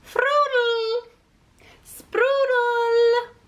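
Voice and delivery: high, loud silly cry; gleeful silly blurt. Acted. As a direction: burst out with a giddy nonsense word. Bird blurts silly word.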